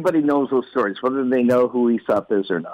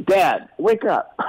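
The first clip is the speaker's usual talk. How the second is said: put-on a higher, slow little-kid plea; child voice; 6 semitones higher, slower than usual. Boy waking his dad on Saturday, a slow high plea. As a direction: wake sleepy dad like a five-year-old. Five-year-old waking father.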